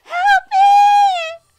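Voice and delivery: put-on high, loud children's cry for help; terrified screams. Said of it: "simply screaming in terror".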